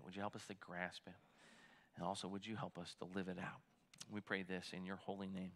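soft, hushed prayer voice; quiet church prayer. Acted. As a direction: pray gently over the congregation. Pastor praying before the sermon.

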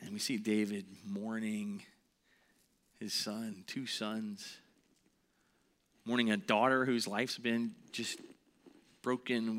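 ordinary talk

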